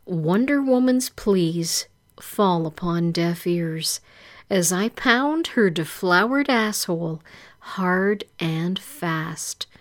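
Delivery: ordinary delivery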